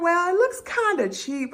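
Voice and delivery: very high, careful friend voice; imitating a friend politely hedging; tactful criticism. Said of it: "try to say it in a nice way".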